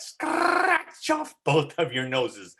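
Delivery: "Scratch" stressed and slowed; teacher echoing the line with extra weight; special emphasis. Shown as a demonstration. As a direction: lean on "Scratch off" slowly. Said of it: "give a little special emphasis to some words".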